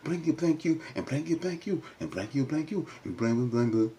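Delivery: mock cursing out. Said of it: "and Carter said".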